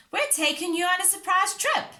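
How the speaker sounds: high, loud excited announcement; excited cry. A narrator's reading. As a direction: announce the surprise with glee. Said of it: "cried Peppa".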